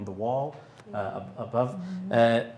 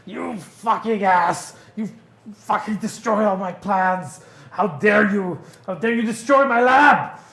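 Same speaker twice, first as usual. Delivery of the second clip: furious Tina; 10 semitones higher, 10 dB louder than usual. Acted. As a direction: scream it at him, she's lost it over her ruined lab. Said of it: "she's really fucking pissed at him".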